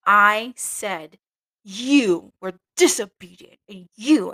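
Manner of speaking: loud scolding novice mistress; retold scolding. Novice mistress scolding a nun, loud and slow. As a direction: raise the voice and scold, slow on disobedient. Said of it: "and she said".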